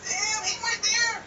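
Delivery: high, drawn-out amazed exclamation; stunned reaction. Retold or imitated. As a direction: replay your shock in a high, stretched voice. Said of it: "I was like".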